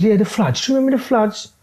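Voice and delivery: a high, odd caricature of a hard man asking a question; Charlie Bronson impression. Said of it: "He goes".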